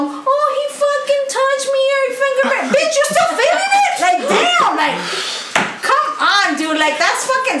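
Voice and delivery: high laughing mockery; mocking falsetto through laughter. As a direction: squeal it out in a laughing mock.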